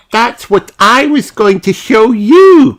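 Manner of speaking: high, loud happy cry; excited cry; crying out happily. Acted. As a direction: shout with delight. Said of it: "cries Peppa".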